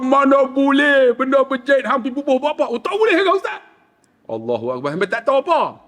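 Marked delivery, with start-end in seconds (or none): 0.0-2.7 high, amused exclamation of protest; laughing mock scolding. Ustaz objects to flowers sewn on ihram, high amused scolding. 2.7-3.6 very high, comic startled question; surprised pilgrim. Retold or imitated. Pilgrim learns sewn ihram is forbidden, high startled question.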